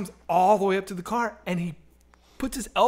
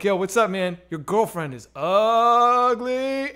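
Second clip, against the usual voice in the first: drawn-out, louder heckle on the last word; yelled taunt; 3 semitones higher, 4 dB louder, slower than usual. Retold or imitated. Teen heckling a gangster from a passing car, taunting. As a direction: reenact a teasing call from a car window.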